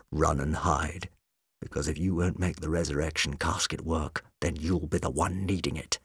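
quiet menace, every word a threat; threateningly quiet. A narrator's reading. McCafferty threatening the Doctor, low quiet menace. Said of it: "his voice threateningly quiet".

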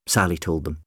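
usual speaking voice

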